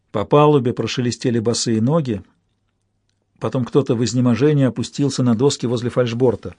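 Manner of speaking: ordinary delivery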